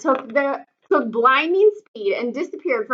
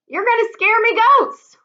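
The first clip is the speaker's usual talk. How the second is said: put-on high, loud protest of an old goatherd; upset goatherd calling out high; 12 semitones higher, 4 dB louder than usual. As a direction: scold the runners for scaring the goats.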